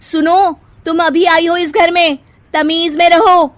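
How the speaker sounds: loud, high, menacing taunt; threatening. Acted. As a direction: threaten her with a sneer. Sister-in-law threatening.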